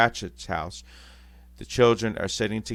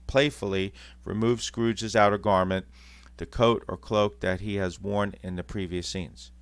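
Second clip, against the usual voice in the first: ordinary delivery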